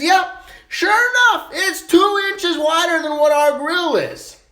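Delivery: hammed-up smug little Johnny; smug kid voice. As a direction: drag it out like a proud little boy. Johnny proudly reports butt measurement.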